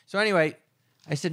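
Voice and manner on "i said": usual speaking voice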